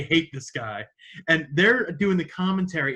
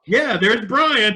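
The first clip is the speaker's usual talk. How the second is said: slow, louder, drawn-out cheer; imitated happy greeting; 6 semitones higher, 7 dB louder, much slower than usual. Violent J spotting his friend on screen, happy cheer. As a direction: cheer like seeing an old buddy. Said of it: "Violent J goes".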